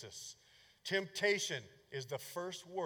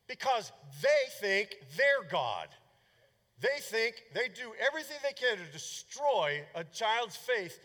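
louder, higher fiery preaching; preaching at full force; 5 semitones higher, 5 dB louder than usual. Acted. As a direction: thunder it from the pulpit. Preacher rails at professors.

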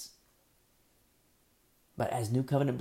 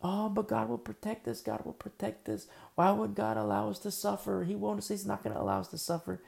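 high, sing-song voice of a naive believer; mock naive believer; 7 semitones higher than usual. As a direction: parody a believer who is sure God spares him. Mocking complacent believers.

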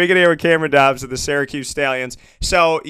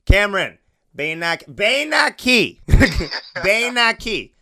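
mock coach command; 5 semitones higher, slower than usual. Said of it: "being like".

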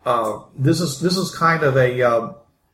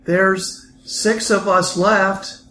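weary survivor; 4 semitones higher, slower than usual. Acted. GM voices weary NPC survivor.

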